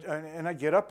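ordinary talk

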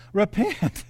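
southern preacher drawl on "Repent"; southern accent. Shown as a demonstration. Preacher has the congregation say it together, southern accent. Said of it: "Say with a southern accent".

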